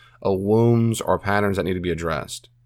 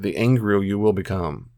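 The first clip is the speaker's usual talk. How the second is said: ordinary delivery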